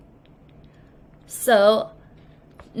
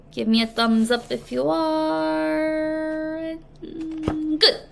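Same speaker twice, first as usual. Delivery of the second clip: higher, slow and soft coaxing; sweet teacher prompt; 5 semitones higher, 3 dB quieter, much slower than usual. Real, not acted. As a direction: gently coax the class for a thumbs up.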